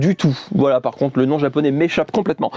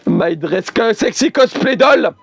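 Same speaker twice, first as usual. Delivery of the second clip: announcer; 6 semitones higher, 4 dB louder, slower than usual. Acted. Announces the figurine title.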